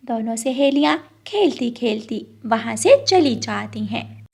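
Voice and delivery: plain reading voice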